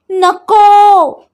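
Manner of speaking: a loud, high, long-drawn नको; frightened refusal. Acted. Babi refusing the flowers in fear, high and drawn out. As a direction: cry out no, dragging it very slowly.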